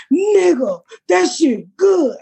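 high, drawn-out fan holler; overfamiliar fan. Retold or imitated. Stranger walking up to praise her, imitated high holler. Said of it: "keep walking up to me saying".

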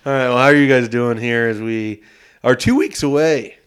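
loud, excited podcast host; hyped-up show opener. Real, not acted. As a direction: sell the countdown with big energy, loud at the start. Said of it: "hear the excitement in my voice".

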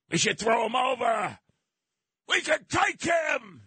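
rally the crew to throw him over; hammed-up mutineer shout; mutineers' shout.

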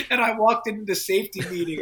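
high burst of laughter into the words; laughing. Real, not acted. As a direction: laugh it out, still giggling. Laughing at his own story.

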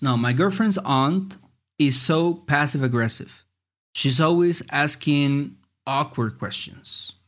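slow, careful read of the quiz line; reading the next prompt slowly to the listeners. Shown as a demonstration. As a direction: take it slowly for the class. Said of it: "cómo dices".

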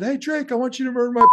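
high-pitched shout to a passerby; retold holler to get someone's attention; calling out. Fan hollers at [name] as he walks by, calling out.

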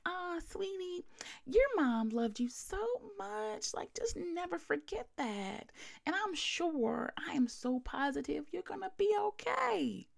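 mock the insincere mourner sweetly; annoying fake-sweet lady. Mocking the fake-sweet lady at mom's funeral, high and saccharine. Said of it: "She was real annoying".

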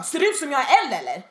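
mock outrage; offended customer. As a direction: act out an affronted customer. Offended customer snaps back at colleague.

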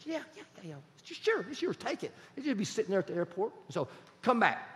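high, quiet, airy yes-man voice; pastor's reply. Retold or imitated. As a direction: play the pastor eagerly giving in. Pastor voiced agreeing to lend car.

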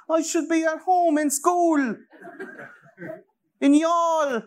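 put-on high, slow, squeaky parody of a teen activist; mock Greta voice. Mocking Greta to his podcast friends, in a high slow squeak. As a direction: lampoon her with a high, slow quavering voice.

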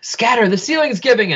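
high, urgent call to scatter; urgent warning shout. Acted. Warning the crew as the ceiling falls, urgent shout. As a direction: yell the warning as the roof caves.

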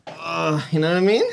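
high questioning catchphrase after a grunt; grunt, then lift into the question; Kevin. Acted. Imitating a colleague on request, high and questioning. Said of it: "Give me your best Kevin impression".

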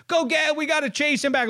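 mock panicky call; urgent pleading. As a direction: imitate pals calling to chase after him. Pals panicking as batter leaves.

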